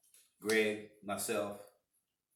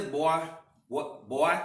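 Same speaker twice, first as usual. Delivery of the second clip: loud, higher-pitched imitation of the owner; restaurant owner's drawl; 7 semitones higher, 8 dB louder, much slower than usual. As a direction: put on the owner's big voice, booming "boy". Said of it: "talked like this".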